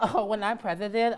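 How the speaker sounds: breaking into a laugh mid-line. Pretend. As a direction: giggle through the words. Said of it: "I can imagine Andrew now as a kid".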